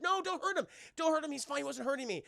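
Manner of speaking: put-on pleading kid.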